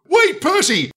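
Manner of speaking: high, urgent cry to stop; panicked high-pitched interjection; alarmed plea. A narrator's reading. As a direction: beg him to hold off. James pleads for his life.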